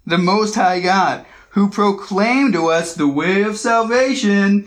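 slow, high-pitched sarcastic proclamation; sarcastic mocking. Preacher acts a possessed girl heckling Paul's party, sarcastic. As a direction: stretch it out in mock awe. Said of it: "doing that sarcastic thing".

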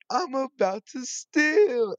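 high, loud, drawn-out mimicry; gleeful slow high-pitched imitation; quoted viral video catchphrase. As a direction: belt out the meme line, stretched and high. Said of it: "say the rest of the day was".

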